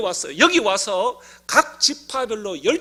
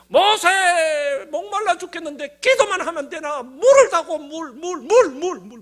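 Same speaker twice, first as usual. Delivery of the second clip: higher, louder crowd yelling for water; angry mob demand; 5 semitones higher, 7 dB louder than usual. Retold or imitated. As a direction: hammer at Moses demanding water. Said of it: "막 하면서 막 입이 막 나가고". (물을 달라고 모세에게 소리치는 백성, 높고 큰 고함)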